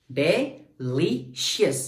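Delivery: "delicious" slowly counted out with gaps; syllable by syllable. Shown as a demonstration. Teacher demonstrating stress, "delicious" in separate syllables. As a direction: pause between de, LI and shus in "delicious". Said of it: "मेरी energy बढ़ रही है".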